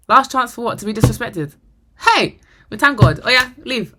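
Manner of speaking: loud, high-pitched outrage; retold outrage at full volume; indignant comeback. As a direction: throw it back at him, loud and incredulous. Her outraged comeback to his last chance.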